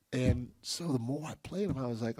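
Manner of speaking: ordinary delivery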